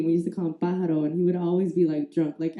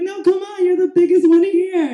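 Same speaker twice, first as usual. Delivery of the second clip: loud, high whine of persuasion; re-enacted urgent coaxing; insistent pleading; 9 semitones higher, 6 dB louder than usual. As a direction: push big brother to go first. Coaxing reluctant brother.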